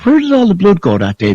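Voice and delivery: normal talking voice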